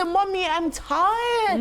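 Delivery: put-on slow, whining kid; mock a whimpering child, slowly; whiny child voice. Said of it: "And they say".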